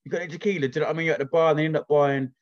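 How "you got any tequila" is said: high, loud hyped-up bar lad; excited lad shouting for sambucas. Retold or imitated. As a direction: play the drunk mate ordering shots.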